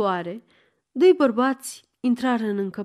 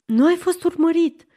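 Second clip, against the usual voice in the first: higher, mildly frightened questioning; alarmed question; 7 semitones higher, 4 dB louder than usual. A narrator's reading. Frightened girl asks her visitor if he was followed, alarmed. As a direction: ask it with a touch of alarm. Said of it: "cu priviri îngrozite".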